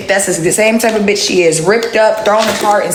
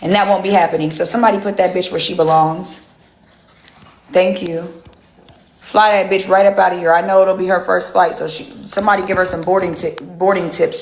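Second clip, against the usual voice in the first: usual speaking voice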